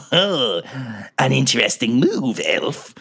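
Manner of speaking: slow, gloating monster voice; giant-eyed monster. Acted. Monster behind the elf at the wheel, gloating. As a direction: savour it like a scheming beast. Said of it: "giant-eyed monster cackle and be like".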